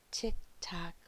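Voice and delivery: ordinary delivery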